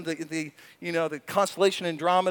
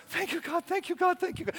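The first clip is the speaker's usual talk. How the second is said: higher, softer quick thanks; hushed thanks; 9 semitones higher, 6 dB quieter than usual. Shown as a demonstration. Preacher murmuring thanks to God, hushed. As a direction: murmur it in awe.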